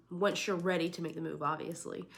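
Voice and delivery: ordinary talk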